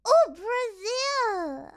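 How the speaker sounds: loud, high, drawn-out delighted exclamation; excited greeting. Real, not acted. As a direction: exclaim it slowly in real delight. Greets viewers from Brazil with delight.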